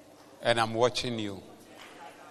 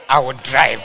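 mock bold shout; 10 dB louder than usual. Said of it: "God has given me a car".

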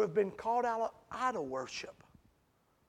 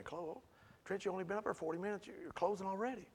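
mock listener complaint; 8 dB quieter, faster than usual. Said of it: "I know you're thinking".

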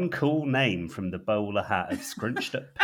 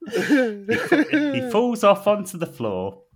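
louder and higher through laughter; cracking up at own joke; laughing; 13 dB louder than usual. Real, not acted. Narrator losing it at his own script, laughing. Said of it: "really made me laugh".

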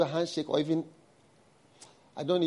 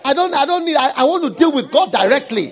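full-force preaching; 9 semitones higher, 10 dB louder than usual. Acted. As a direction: hammer each phrase at the congregation.

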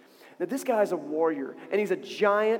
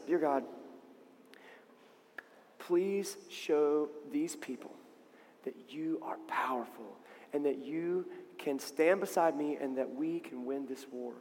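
low, slow, near-whispered plea; hushed prayer; 6 semitones lower, 10 dB quieter than usual. Acted. David prays before battle, hushed and slow. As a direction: pray very softly, with long pauses. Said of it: "he prays".

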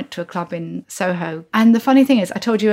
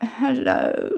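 small, higher limp hello; limp greeting; 4 semitones higher, 3 dB quieter than usual. Retold or imitated. Shy greeting to her crush, limp and mortified. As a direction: say hello as limply as the handshake. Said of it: "a really limp handshake, kind of like".

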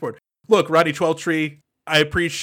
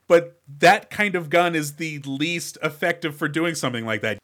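ordinary delivery

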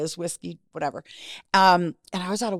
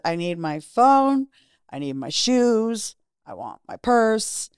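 slow, insistent list, pitched up; demanding; 4 semitones higher, slower than usual. Retold or imitated. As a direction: retell each demand slowly and firmly. Retells demanding belongings at front desk.

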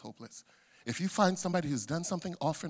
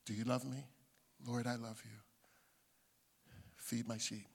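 low, soft, solemn question and answer; tender exchange; 8 semitones lower, 13 dB quieter than usual. Acted. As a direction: voice an intimate dialogue very quietly. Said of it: "he says three times again".

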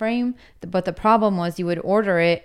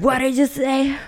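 mock outrage; 7 semitones higher, 3 dB louder than usual. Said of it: "What did he say to me".

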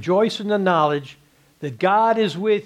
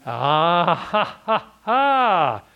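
short mocking laugh; much slower than usual. Real, not acted.

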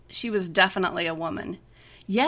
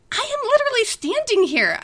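hissed; 7 dB louder than usual. A narrator's reading. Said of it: "I hissed".

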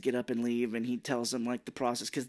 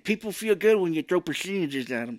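higher, louder wet-mouthed impression; spitty dentures voice; 5 semitones higher, 4 dB louder than usual. Podcaster imitating an actor's dentures, spitty mimicry. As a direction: mimic talking through loose dentures. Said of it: "I don't have enough spit to get there".

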